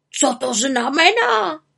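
high, drawn-out alarmed question; startled. A narrator's reading. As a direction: ask in fright as the raven. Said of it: "polekal se Abraxas".

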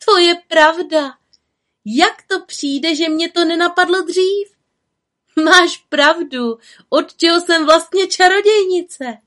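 high, loud eager voice; excited little witch. A narrator's reading. Little witch realises she could have used magic, excited. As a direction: marvel at your own forgetfulness.

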